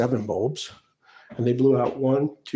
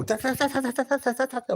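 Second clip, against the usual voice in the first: mocking chatter; 11 semitones higher than usual. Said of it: "like, people go".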